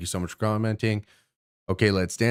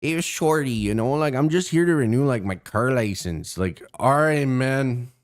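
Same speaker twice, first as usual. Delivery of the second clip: higher-pitched, swinging Toronto mans thug act; mock Toronto mans accent; 4 semitones higher than usual. Streamer mimicking a would-be thug renewing his license, Toronto accent. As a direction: put on a mocking Toronto slang accent. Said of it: "like, thug".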